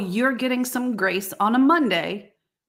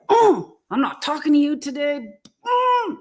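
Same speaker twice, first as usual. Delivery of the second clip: mock huffy snub; 6 semitones higher than usual. Angry spouse after dream.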